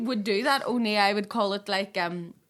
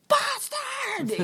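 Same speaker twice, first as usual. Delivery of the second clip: angry yell; 13 semitones higher than usual. Retold or imitated. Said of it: "hit the shower door and be like".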